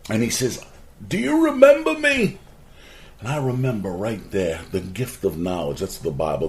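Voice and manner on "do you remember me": high, loud retold yell. Neighbor kid yelling down to him from the roof, high loud yell. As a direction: yell it down from the rooftop, much higher. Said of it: "he yells down".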